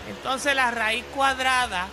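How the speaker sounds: high, slow droning teacher. Acted. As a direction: lecture slowly like a tired teacher. Classroom skit, teacher lecturing.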